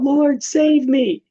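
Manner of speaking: high, slow, drawn-out plea; hammed-up cry for rescue; crying out afraid. Sinking Peter calls to Jesus, acted frightened cry. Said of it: "cried out".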